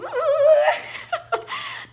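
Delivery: a high amused laugh; high giggle. Acted. Laugh before mock voice.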